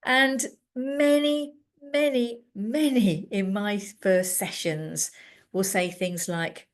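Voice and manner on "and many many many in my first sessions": many, many, many drawn out slowly and high; stretched emphasis. Real, not acted. Therapist stressing how often this happens, slow. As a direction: lean on each many, slowly. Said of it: "repeated emphasis".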